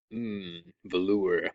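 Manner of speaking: soft, low, drawn-out purr; mock-sensual relish. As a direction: savour it slowly, quietly, pretentiously. Hamming up affected French-style words.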